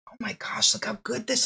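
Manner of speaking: breathy whisper of delight; hushed admiring whisper over the fabric; whispered awe. Real, not acted. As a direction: whisper it like you can't believe it.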